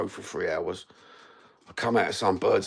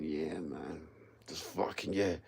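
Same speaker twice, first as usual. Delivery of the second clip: much softer, lower, dazed and drifting; stoned mumble; 7 semitones lower, 14 dB quieter, slower than usual. Retold or imitated. Stoned mate in the car gazing out the window, dazed mumble. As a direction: mumble it like you're off your head in the back seat.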